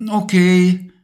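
put-on high, a little loud child. A narrator's reading. Levi answering his mum, light child voice. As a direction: answer like a kid.

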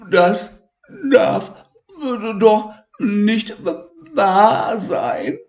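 high, halting, near tears; high voice breaking into tears; stammering. Acted. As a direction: choke the words out one by one. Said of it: "stotterte Elmar".

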